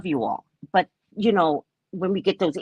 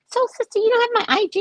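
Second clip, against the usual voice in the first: high, fast imitation of a fan; mock fan voice; 12 semitones higher, 4 dB louder, faster than usual. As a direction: chirp it like an eager fan.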